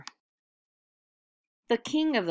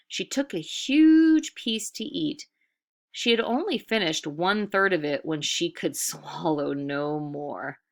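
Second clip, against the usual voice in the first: plain reading voice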